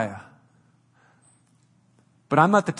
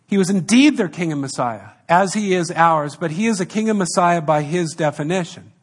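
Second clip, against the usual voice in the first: usual speaking voice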